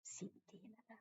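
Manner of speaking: hushed aside, no voice, breath only; whisper. Real, not acted. Whispering an aside to someone in the room. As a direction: whisper it so the stream barely hears.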